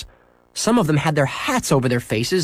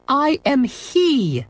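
much higher, slow schoolteacher correcting; prim correction; 14 semitones higher, much slower than usual. Acted. Former teacher correcting Henry's grammar, prim and slow. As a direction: enunciate it like a fussy teacher. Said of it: "corrected the lady with the apples".

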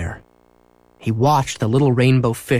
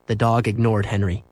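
usual speaking voice

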